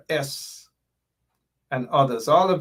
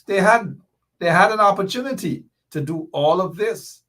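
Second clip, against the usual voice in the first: louder, higher, heated outburst; getting carried away with outrage; worked up; 7 semitones higher, 3 dB louder than usual. Real, not acted. Ranting to viewers about the government's failure, worked up and louder. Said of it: "I'm getting all worked up".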